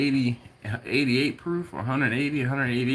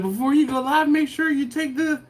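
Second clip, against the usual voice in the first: imitating wife; 10 semitones higher, 4 dB louder than usual. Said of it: "She said".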